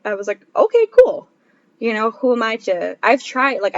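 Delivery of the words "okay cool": chirpy okay. Retold or imitated. Retold own quick acceptance.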